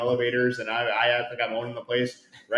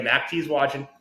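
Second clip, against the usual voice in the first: usual speaking voice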